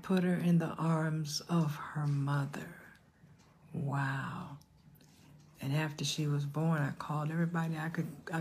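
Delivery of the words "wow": ordinary talk, nothing marked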